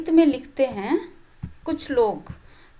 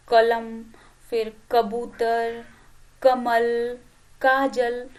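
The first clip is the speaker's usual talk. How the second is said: "कलम", "कबूतर", "कमल", "काजल" each with the क said louder, "कबूतर" slow; stress on क; 2 semitones lower, 4 dB louder, much slower than usual. Shown as a demonstration. Teacher lists क words for children, क stressed. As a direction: hit the opening क of every word. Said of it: "क शब्द इन सब में है".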